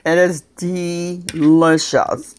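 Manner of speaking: slow, stretched-out savouring; slow and emphatic, relishing it; drawn-out relish. Real, not acted. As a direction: linger over each word with relish. Savouring the food on camera.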